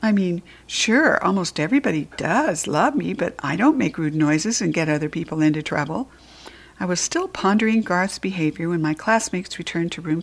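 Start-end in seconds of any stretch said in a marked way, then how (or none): none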